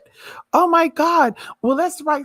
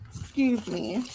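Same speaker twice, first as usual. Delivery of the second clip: much softer, breathy apology; soft excuse me; 10 dB quieter, slower than usual. Real, not acted. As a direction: murmur it softly. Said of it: "I got a little excited there".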